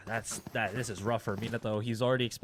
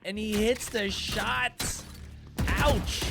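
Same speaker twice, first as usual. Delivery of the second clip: esports caster; 8 semitones higher, slower than usual. Real, not acted. Caster calling a 1v1 shot.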